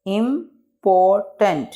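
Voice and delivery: Important slowly as im, por, tent; three spaced beats on important; broken into syllables. Shown as a demonstration. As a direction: pause after each syllable of important. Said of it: "इसको pronunciate कर लेते हैं".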